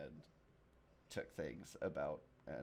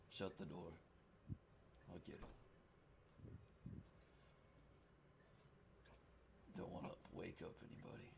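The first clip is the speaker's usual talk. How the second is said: hushed; 10 dB quieter, faster than usual. Acted. Drunk man hushes visitor.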